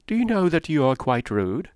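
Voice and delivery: high, breathless society gossip; chattering Mrs Palmer. Acted. Mrs Palmer prattling to Eleanor and Marianne, high and chirpy. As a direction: prattle on brightly without pausing.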